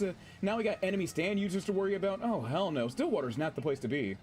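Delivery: ordinary delivery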